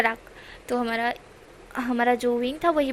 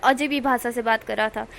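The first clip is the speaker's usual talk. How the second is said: ordinary delivery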